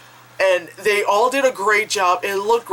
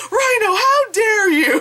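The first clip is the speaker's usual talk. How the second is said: put-on high, slow childish outrage; indignant kid impression; 4 dB louder, slower than usual. Vlogger repeats the kid's rebuke to Rhino, indignant kid impression. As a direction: cry it out like an affronted little kid. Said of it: "The kid goes".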